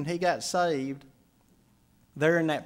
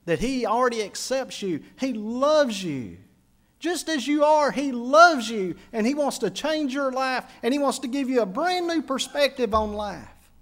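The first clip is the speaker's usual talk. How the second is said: impassioned gospel appeal, higher and louder; fervent preaching; 8 semitones higher, 6 dB louder than usual. Real, not acted. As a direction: pour it out to him like an altar call.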